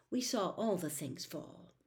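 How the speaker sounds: ordinary delivery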